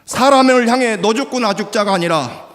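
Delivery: high, loud cry of 너 죽고 나 죽자; preaching shout. Real, not acted. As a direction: belt it at the congregation.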